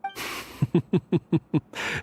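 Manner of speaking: hearty laugh. Acted. Finds the remark funny.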